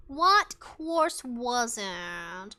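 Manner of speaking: very slow, stretched-out question; drawn-out pondering. Real, not acted. Streamer trying to recall a college course, drawn-out pondering. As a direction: stretch each word while searching memory.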